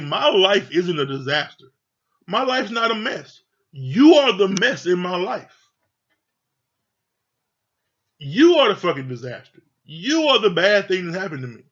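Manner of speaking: loud, rising angry comeback, pitch up; angry retort. Retold or imitated. Mark shouting back at Lindsay after her insult, angry yell. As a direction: throw it back at her, furious.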